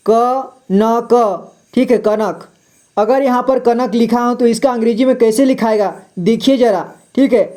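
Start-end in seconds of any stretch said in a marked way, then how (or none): 0.1-1.5 क न क, each syllable said slowly and apart; क न क, split into three separate slow syllables. Shown as a demonstration. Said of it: "जैसे यहाँ पर". (अक्षर अलग-अलग धीरे-धीरे)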